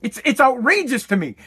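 normal talking voice

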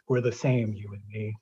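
low, hushed and sinister, fading to almost nothing; a killer leaning in close, softly; menacing killer whisper. Acted. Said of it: "He does many times".

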